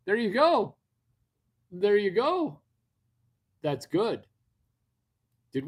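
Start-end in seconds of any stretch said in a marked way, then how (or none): 0.1-0.8 loud, high praise; a burst of loud, high praise; excited cheer. Real, not acted. Cheering a viewer on. 1.7-2.6 loud, high praise again in the same spirit; excited cheer. Real, not acted. Cheering a viewer on.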